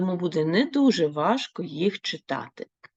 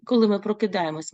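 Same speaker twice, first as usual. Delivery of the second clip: ordinary talk, nothing marked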